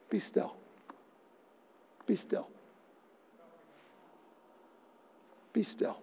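quiet, hushed, calm "Be still"; soft command. Retold or imitated. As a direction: say it softly, stilling the storm. Jesus calming the storm.

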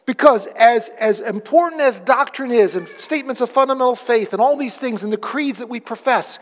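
normal talking voice

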